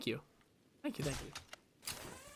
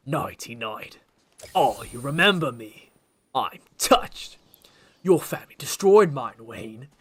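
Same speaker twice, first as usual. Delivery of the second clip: gleeful, louder sneering villain, slow at the end; Penguin impression; 5 dB louder, slower than usual. Gamer acting the Penguin's revenge speech. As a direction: ham up the vengeful Penguin. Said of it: "The Penguin voice goes crazy".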